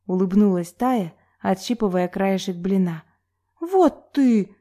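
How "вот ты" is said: high-pitched huffy exclamation; offended, pitch leaping up; sulky pout. A narrator's reading. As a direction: pout in indignation. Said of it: "надулась Соня".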